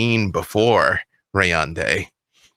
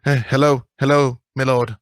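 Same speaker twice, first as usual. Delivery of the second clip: put-on higher, stammering boy's voice; a frightened child greeting the lord; shivering boy stammers a hello to his lord; nervous young boy; 4 semitones higher, 3 dB louder than usual. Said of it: "And he looks up and says".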